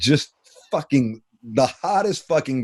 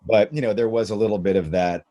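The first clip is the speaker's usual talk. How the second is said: normal talking voice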